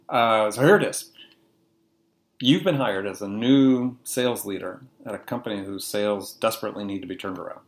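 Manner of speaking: ordinary delivery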